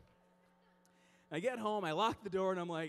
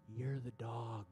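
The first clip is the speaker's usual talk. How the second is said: voice of God; much slower than usual. Retold or imitated. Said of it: "he's like".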